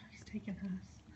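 hushed unvoiced aside; breathy secret aside; whisper. Real, not acted. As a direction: whisper it so the dogs won't notice. Aside whisper about sneaky dog.

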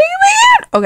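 extremely high, squeaky exaggeration; hammed-up high-pitched reaction; mock squeal. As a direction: squeal it at the top of your range. Acting out own texted reaction.

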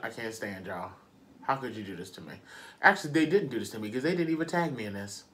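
ordinary talk, nothing marked

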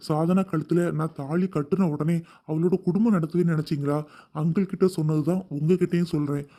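deep man's voice, mock-sincere and earnest; low, pleading sincerity; heartfelt. A narrator's reading. Groom earnestly persuades the mother, deep and heartfelt. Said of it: "உருக்கமாக கூறினான்".

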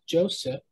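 "Joseph" with a clear final F; "Joseph" closed on F, not P; Joseph ending in F. Shown as a demonstration. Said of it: "It ends with an F".

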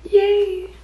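high, loud little cheer; excited cheer. Real, not acted. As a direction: squeal it with joy. Cheering about traditions.

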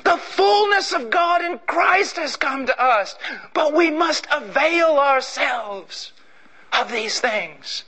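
high, slow, intoned declaration; solemn proclamation. Acted. Preacher proclaiming the end of the ages, slow and high. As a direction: intone each word like a revelation.

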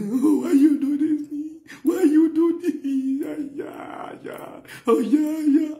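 high, hammed-up woman's voice; imitating a woman. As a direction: squeal it like the woman in bed. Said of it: "you say".

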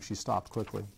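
ordinary delivery

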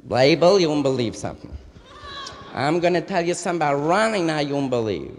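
gossipy Yiddish mama; Yiddish accent. Retold or imitated. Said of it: "Dig the mother".

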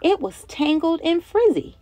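lively, sing-song picture-book reading; reading to children. A narrator's reading. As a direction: read it like story time for little kids.

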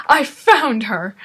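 loud, drawn-out imitation of a guard; mock announcing. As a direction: call it out slowly in mock triumph. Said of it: "You're just saying".

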